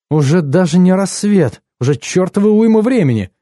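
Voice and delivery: high, animated outcry in character; dismayed exclamation. A narrator's reading. Woman realising morning came long ago, exclaiming. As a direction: exclaim with the pitch well up. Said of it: "воскликнула Энни".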